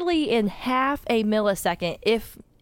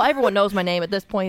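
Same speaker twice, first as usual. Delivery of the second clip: ordinary talk, nothing marked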